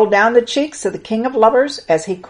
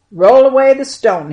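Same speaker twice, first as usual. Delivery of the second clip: loud, higher command; 6 semitones higher, 8 dB louder than usual. A narrator's reading. Jesus ordering the mourners at the tomb, loud command. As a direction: call out with authority. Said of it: "he commanded".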